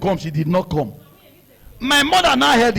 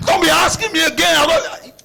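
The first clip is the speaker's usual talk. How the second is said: shouting back at dad; retold shout; 8 semitones higher than usual. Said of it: "he was shouting".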